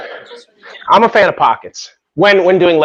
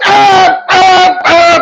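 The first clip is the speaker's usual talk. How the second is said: loud, high cheering; shouting encouragement; 13 semitones higher, 4 dB louder, much slower than usual. Real, not acted. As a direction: yell them through the lift. Spotters cheering lifter on.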